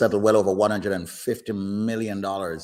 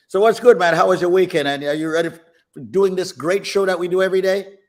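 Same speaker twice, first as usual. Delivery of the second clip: excited; 10 semitones higher, 8 dB louder than usual. Acted. Host hyping up the show.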